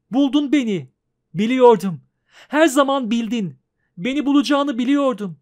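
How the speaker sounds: cling and cry out in joy; little girl overjoyed at being found; joyful girl. Acted. Girl joyful in witcher's arms.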